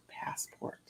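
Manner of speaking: whispered, breath only; hushed reveal. Real, not acted. Finding her late husband's passport, whispered. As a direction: drop to a whisper on the word.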